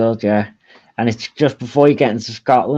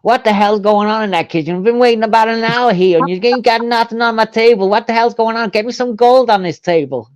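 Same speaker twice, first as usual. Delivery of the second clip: Jeffrey Epstein impression; 6 semitones higher than usual. Liverpudlian doing his Epstein accent for a friend to guess, exaggerated American. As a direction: complain in a put-on New York-style American voice. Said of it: "let me do me accent I do for".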